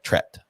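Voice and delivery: "Tret" with a plain T in place of the TH; imitating a botched accent on "Tret"; imitated mispronounced threat. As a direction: say "Tret" as one short accented word, no TH. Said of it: "but she says it like".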